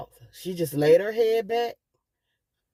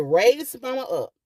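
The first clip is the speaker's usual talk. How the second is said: urgent retold order; 7 semitones higher than usual. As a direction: take charge in the crisis. Retelling urgent commands in emergency.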